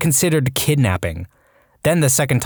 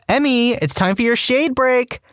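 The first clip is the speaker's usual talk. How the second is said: put-on high sing-song call to a child; lightly voiced chirpy mom; mock mom voice; 11 semitones higher than usual. Mother calls out on whistle.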